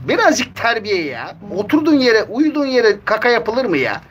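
high mock-scolding pet voice; scolding the cat. Real, not acted. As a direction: tease the cat like a fussy parent. Kediyi şakayla azarlıyor. (kediyi tiz sesle şakacı azarlama)

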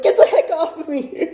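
high, laughing plea to get off; laughing protest. Retold or imitated. Telling Alex to get off him, high laughing protest. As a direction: laugh through the complaint. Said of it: "I look at Alex like".

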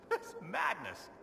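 amused scoff at a silly tale; amused laugh. Real, not acted. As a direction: chuckle at the absurd rumour. Mocking ridiculous foreign tales.

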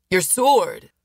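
sudden call from afar; urgent shout. Acted. Unknown voice calls to Hale.

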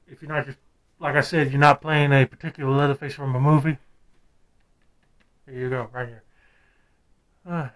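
muffled behind full head mask; speaking inside rubber mask; through a face mask. Real, not acted. Seen 2: Full over-the-head latex mask covers face and mouth throughout; R frames unmasked.

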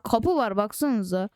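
ordinary talk, nothing marked

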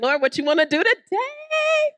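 high bright eager question. Acted. Pastor eagerly asking the Lord each Sunday. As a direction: ask it with excited delight.

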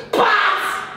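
sudden loud, high smack noise; imitated slap. Pupils expecting the flat hand, explosive slap sound. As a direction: burst out like a hard slap.

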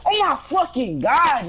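angry yelling. Real, not acted. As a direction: yell at the unfair call. Gamer furious at bad call.

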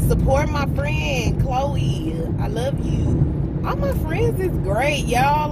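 excited shout-out to her friends; gushing shout-out. Real, not acted. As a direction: lift the voice, beam at your friends.

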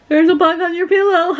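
high sing-out breaking into a mock laugh; high, gleeful imitation, laughing; imitating teasing laughter. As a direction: mimic someone laughing as he says it. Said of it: "He's laughing at me".

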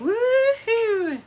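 high, loud woohoo; excited whoop. Real, not acted. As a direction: whoop with delight. Gemstone ring revealed.

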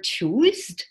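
"choose" said as "choozd", rising doubtfully; wrong past form. Shown as a demonstration. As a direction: query "choose" with a false D ending. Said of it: "Well, that's wrong".

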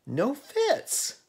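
high, loud, voiced searcher; calling out. A narrator's reading. Searching in vain for the cat.

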